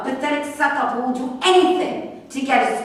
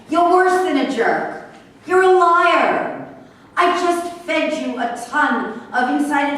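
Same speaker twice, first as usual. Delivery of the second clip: louder, higher, biting accusation; angry outburst; 5 semitones higher, 5 dB louder than usual. Acted. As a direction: spit out the insult. Accusing the reporter angrily.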